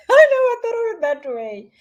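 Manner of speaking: tickled, voice jumping up high; amused. Real, not acted. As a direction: say it through a real laugh. Laughs at a viewer's phrase.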